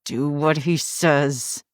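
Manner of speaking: slow, through gritted teeth; forced out through clenched teeth. Acted. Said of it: "between gritted teeth".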